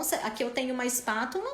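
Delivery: ordinary delivery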